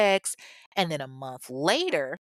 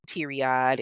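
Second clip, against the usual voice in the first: usual speaking voice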